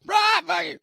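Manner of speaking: very high, loud parrot screech; squawking parrot. Acted. Parrot defying the knife threat, loud high squawk. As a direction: blurt it out as a squawking bird. Parrot insults owner once more.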